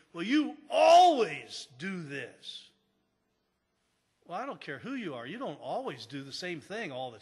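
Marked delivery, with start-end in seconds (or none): 0.1-2.7 loud, slow accusing jab; accusing spouse. Retold or imitated. Spouse attacking their partner, loud and slow. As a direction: throw the blame at your spouse. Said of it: "they come at them and they say".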